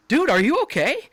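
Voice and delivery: high, loud worried question; retold alarm. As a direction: check urgently on a hurt buddy, re-enacted. Retold alarm at bleeding friend.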